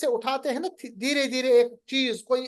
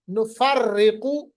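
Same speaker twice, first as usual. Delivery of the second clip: very slow, louder drawn-out chant; Quran recitation; 5 dB louder, much slower than usual. Shown as a demonstration. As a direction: stretch every syllable in recitation. Teacher recites Quran words.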